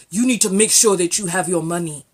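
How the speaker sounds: put-on African accent. Vlogger mimics African men lecturing women on money, African accent. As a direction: imitate an African man in a low voice. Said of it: "have you seen any African men of means coming on talking".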